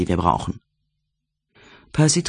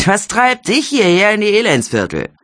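higher, brassy Cockney lady trying to sound proper; a barmaid putting on airs, pitched up; Jelly's genteel Cockney; 10 semitones higher, 4 dB louder than usual. A narrator's reading. Jelly teases Percy about visiting the slums, higher would-be posh Cockney. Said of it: "Sie klang wie eine Cockney, die ein paar Lektionen in korrekter englischer Aussprache erhalten hatte".

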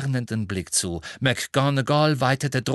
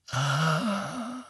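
soft, gentle ah; mild; 4 semitones higher, 4 dB quieter than usual. A narrator's reading. Headmaster mildly answers the minister, soft. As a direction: let it out mildly. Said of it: "sagte Dumbledore milde".

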